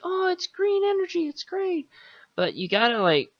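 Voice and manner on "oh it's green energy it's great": high, mocking sing-song; sarcastic eco-enthusiast impression, pitched way up; mock hippie. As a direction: imitate a naive green-energy fan, much higher. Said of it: "Everyone's like".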